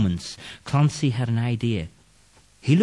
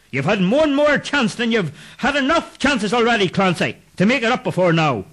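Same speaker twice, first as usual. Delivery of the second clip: higher, louder, fast; ghost scolding; 11 semitones higher, 3 dB louder than usual. Acted. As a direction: cut him off. Ghost rejects plea.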